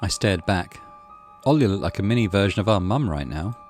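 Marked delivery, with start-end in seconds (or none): none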